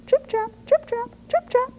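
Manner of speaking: very high, light trip trap; tiny hoof steps. A narrator's reading. Smallest goat crossing the bridge, squeaky high steps. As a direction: tap it out in a squeaky little voice.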